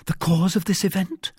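high, mildly embarrassed question; a touch of embarrassment, voice lifted high. A narrator's reading. Wife asks whether her husband suspects the cause, mildly embarrassed. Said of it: "with some embarrassment".

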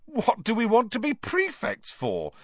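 high-pitched disgusted protest; incredulous, appalled twin; revolted. A narrator's reading. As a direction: recoil at the very idea. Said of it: "looking revolted at the very idea".

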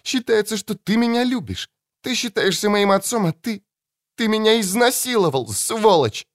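put-on high, shrill-free angry young woman's cry; furious daughter. Daughter's accusation.

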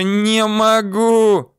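high, loud, stretched-out howl; drawn-out high girl's wail. A narrator's reading. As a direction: wail helplessly, dragging out each vowel. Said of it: "вдруг завыла девочка".

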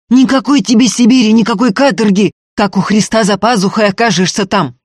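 heated full-voiced urging; yelling. A narrator's reading. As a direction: shout over the argument. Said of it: "орал Ефим".

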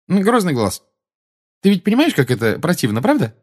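put-on high, breezy young witch's remark; casual remark. A narrator's reading. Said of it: "непринуждённо заметила Тонкс".

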